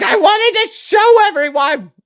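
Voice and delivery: very high, crying child imitation; hammed-up little girl's tearful whine; wailing daughter. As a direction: wail it like a heartbroken kid. Crying daughter voiced by parent.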